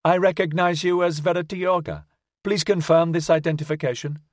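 high, piping machine voice; piping robot voice. A narrator's reading. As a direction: chirp it out like a small robot. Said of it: "spoke in a rapid, piping voice".

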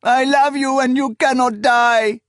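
high, loud, desperate plea; anguished acted cry. A narrator's reading. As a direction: wail it, refusing to accept. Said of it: "she cried".

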